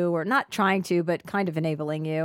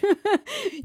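high laugh; 12 semitones higher than usual. Real, not acted.

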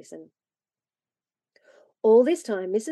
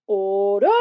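loud, deep call to order; deepest tones; 3 semitones lower, 5 dB louder than usual. A narrator's reading. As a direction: boom it out like a parson. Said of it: "said Parson Henderson in his deepest tones".

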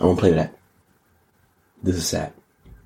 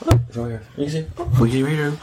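ordinary talk, nothing marked

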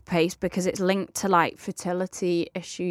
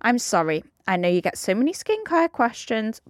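usual speaking voice

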